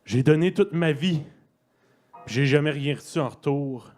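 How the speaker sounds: impassioned spoken-word, bursting high and loud then easing; dramatic recital with emphatic outbursts; anguished poetry reading. Acted. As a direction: cry out in protest, then pull back. Poem rises into anguished outburst.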